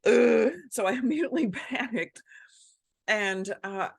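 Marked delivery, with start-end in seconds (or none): 0.1-0.5 loud, high groan of disgust; a big grossed-out ugh; recoiling at the sight of the nest. Retold or imitated. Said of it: "And I was like".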